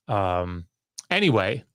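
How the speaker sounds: normal talking voice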